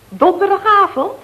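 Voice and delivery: usual speaking voice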